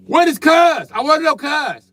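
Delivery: loud high exasperated rant; exasperated yell. Real, not acted. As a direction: demand an answer, fed up. Mocking daughters' answer.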